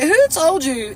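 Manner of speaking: a touch of a sassy challenge; Portia. Acted. God challenging the listener, in a lightly put-on Portia voice. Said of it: "in Portia voice".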